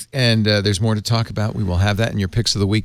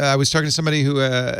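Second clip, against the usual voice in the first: ordinary talk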